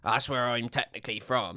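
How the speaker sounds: put-on Southwest England accent; West Country accent. Shown as a demonstration. Streamer explaining his origins, put-on West Country accent. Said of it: "I was gonna say Southwest England".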